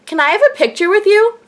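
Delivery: imitating a sweet request, pitched high; really cute. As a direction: ask it in a high, cutesy voice. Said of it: "like, really, like, cute".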